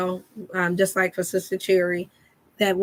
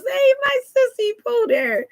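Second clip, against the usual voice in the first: delighted greeting. Real, not acted. Sister pops into the stream.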